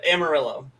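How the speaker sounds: ordinary delivery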